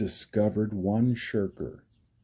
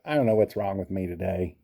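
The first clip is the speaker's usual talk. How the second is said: fast frustrated; 4 semitones lower, 7 dB louder, faster than usual. Real, not acted. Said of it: "I keep just saying the wrong words".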